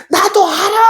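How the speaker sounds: very high, stretched-out mock holler; demonstrated yell. Shown as a demonstration. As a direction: yell it out to let off steam. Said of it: "크게 하면 소리치는 거야".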